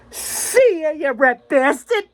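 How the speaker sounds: high, teasing goodbye in a put-on voice; mock cheeky farewell. Pilot leaving the plane after shutdown, high teasing farewell. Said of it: "And it's like".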